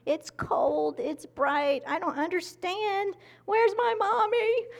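put-on high, bewildered baby voice, rising into a wail; mock newborn baby. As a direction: play a confused newborn crying for mommy. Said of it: "They're like".

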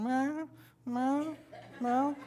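high imitated meows; cat meowing. Pastor imitating his hungry cat, high meows. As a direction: meow up high like a needy cat. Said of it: "One of them was just kinda like, going".

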